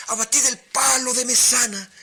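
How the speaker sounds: high, loud echoed shout from the crew; sailors shouting back. A narrator's reading. Sailors shouting the captain's order back, loud high shout. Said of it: "Y todas y todos".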